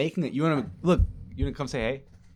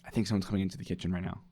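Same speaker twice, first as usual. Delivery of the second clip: hushed; 5 semitones lower, 10 dB quieter, much faster than usual. Real, not acted. Hushed aside as roommate enters.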